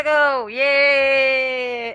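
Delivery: loud happy yay; excited cheer. Real, not acted. Streamer cheering to chat as the match starts, loud and happy.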